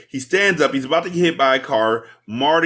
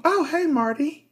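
much higher, soft, girlish mock voice; young sweet voice; 13 semitones higher, 4 dB quieter than usual. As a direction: flip into the sweet teenage girl, way up high. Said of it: "And then her voice goes from".

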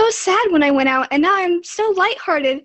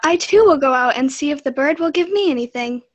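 put-on girl's voice, much louder and higher; 3 semitones higher, 15 dB louder than usual. Marlinkin heading out to the bird, loud and high. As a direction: call it out as the eager girl. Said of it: "Said Marlinkin".

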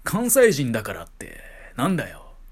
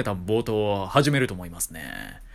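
usual speaking voice

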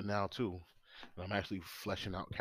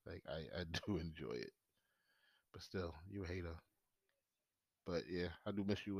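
normal talking voice

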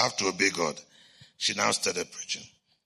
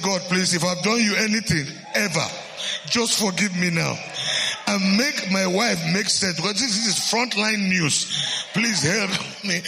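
tearful plea; 8 semitones higher than usual. Retold or imitated. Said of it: "Now I was the one crying".

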